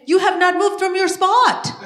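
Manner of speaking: high, loud mock scolding; exasperated mom. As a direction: scold the kid in acted exasperation. Exasperated mom answering child, acted.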